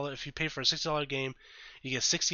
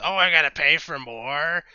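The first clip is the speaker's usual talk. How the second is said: loud mock griping; mocking outburst of indignant complaint; upset complainer; 3 semitones higher, 11 dB louder than usual. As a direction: imitate upset people complaining, much louder. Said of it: "people get upset with it".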